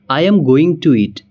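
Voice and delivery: slow, careful going to eat; full going to. Shown as a demonstration. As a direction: spell out going to word by word. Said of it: "going to हम नहीं बोलेंगे".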